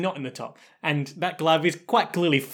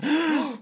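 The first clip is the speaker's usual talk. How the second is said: high shocked gasp; sharp high gasp; 12 semitones higher than usual. Real, not acted. Reacts to plot twist.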